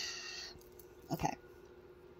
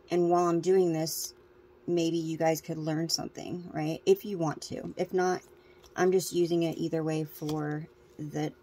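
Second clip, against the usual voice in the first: ordinary delivery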